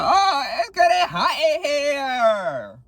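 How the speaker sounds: very high, drawn-out mock wail; hammed-up turned-on wail. Joking about being turned on by the ex's message, hammed-up wail. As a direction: stretch it out in an exaggerated high cry.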